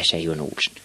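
usual speaking voice

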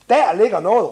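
put-on boy's eager cry, as read aloud; excited call. A narrator's reading. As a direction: call out a find in the story. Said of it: "råbte Lille Virgil".

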